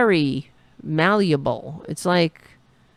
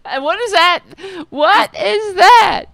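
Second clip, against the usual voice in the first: laughing disbelief; 14 semitones higher, 7 dB louder than usual. Real, not acted.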